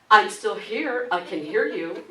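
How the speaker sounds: mock doctor calling through the door; called from hallway. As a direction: project it from the hallway. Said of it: "says from the hallway".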